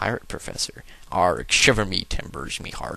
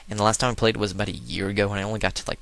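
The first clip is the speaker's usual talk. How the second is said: ordinary talk, nothing marked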